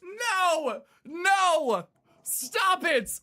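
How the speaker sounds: incredulous, almost pleading; shocked disbelief. Real, not acted. Streamer can't believe the next game, high shocked disbelief. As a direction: pitch shoots way up, stretched "What is happening".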